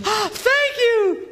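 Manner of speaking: mock delighted wife. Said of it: "and she goes".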